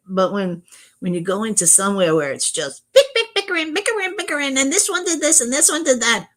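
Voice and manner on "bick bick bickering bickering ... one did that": high squeaky mimicry of squabblers; mock bickering. Streamer imitating people fighting in chats, mock bickering. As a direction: chatter the squabble in a very high put-on voice.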